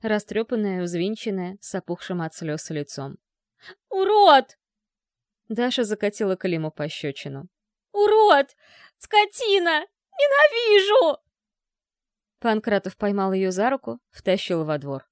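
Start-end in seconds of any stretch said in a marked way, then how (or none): 3.9-4.6 screaming. Acted. Dasha screams at Klim. 7.9-11.2 hysterical screaming. Acted. Dasha screams insults.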